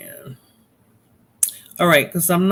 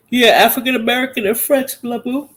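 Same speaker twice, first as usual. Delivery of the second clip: higher, louder, sing-song reading of the comment; a loud excited misread; loud slip; 7 semitones higher, 5 dB louder than usual. Real, not acted. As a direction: blurt it out fast and bright. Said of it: "Ooh, excuse me".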